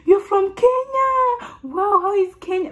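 very high, drawn-out mimicked amazement; gushing high-pitched put-on wow; slow-speaking lady. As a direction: imitate a lady talking slowly to a foreigner. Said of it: "speaking slowly".

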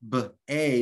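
"B, A" as two separate sounds; sounded out. Shown as a demonstration. Teacher showing an open syllable, "B, A" sounded out. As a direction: split the first syllable of bacon: "B, A". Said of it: "there are two sounds".

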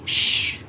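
"Sh" alone as a long hushing sound; heavy SH sound. Shown as a demonstration. Teacher isolating the sound for students, heavy SH. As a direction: hold "Sh" as one strong consonant. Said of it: "âm sh nặng".

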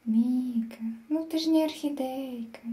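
ordinary talk

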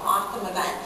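ordinary delivery

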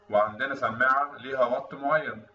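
normal talking voice

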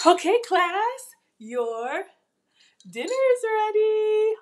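high, slow mock teacher announcement; hammed-up schoolteacher calling the class to dinner; playful teacher.

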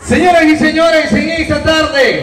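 loud, high showman call; announcer shout. Acted. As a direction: announce it to the crowd. Introducing the show announcer-style.

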